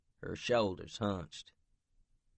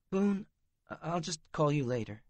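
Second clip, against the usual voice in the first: higher, fast and rattled; flustered young woman; 9 semitones higher, 5 dB louder than usual. Acted. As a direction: rush it out, embarrassed. Ivy flustered, female voice.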